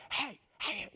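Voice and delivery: high, strained stage whisper; dad's failed whisper. Retold or imitated. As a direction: try to whisper and fail comically. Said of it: "Dad never knew how to whisper".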